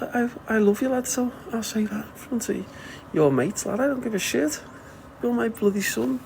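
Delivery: high-pitched, blunt declaration of love to his son; gushing at son. Retold or imitated. Telling his teenage son he loves him, high and gushing. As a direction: belt it out to the lad, no shame in front of his mates. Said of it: "yeah, I'd be like".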